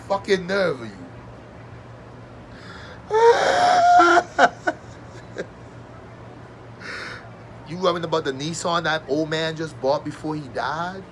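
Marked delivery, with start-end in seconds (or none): 2.5-6.4 high wailing laugh. Acted. Mock-wails over the grandpa scene.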